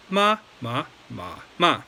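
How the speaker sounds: four deliberate "ma"s, pitch changing each time; four tones in order. Shown as a demonstration. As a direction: show all four Mandarin tones on "ma". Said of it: "So once again".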